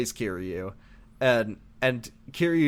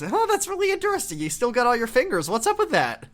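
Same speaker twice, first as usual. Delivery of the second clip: high sneering voice; 10 semitones higher than usual. Retold or imitated. Kuze mocking Kiryu.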